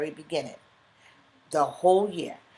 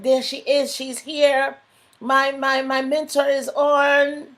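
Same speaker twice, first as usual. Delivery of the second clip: higher and louder, thrilled to see her arrive; light up as your mentor joins; excited welcome; 6 semitones higher, 7 dB louder, slower than usual. Real, not acted. Delighted greeting as her mentor logs on, higher and louder.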